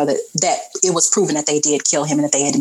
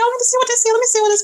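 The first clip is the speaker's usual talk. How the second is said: high, airy mock mama voice, leaning in to look; a mama peeking at a slid-under comic, much higher; curious mama; much faster than usual. Hippolyta picking up the comic, in a high put-on voice. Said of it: "She was like".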